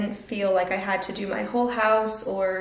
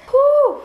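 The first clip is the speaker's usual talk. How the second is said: whew; 11 dB louder than usual. Real, not acted. Relief after moving boxes.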